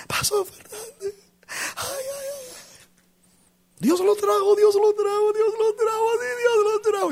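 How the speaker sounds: high-pitched wailing, hammed-up sobs; imitating weeping. Distraught mother greeting her pastor at the door, imitated crying wail.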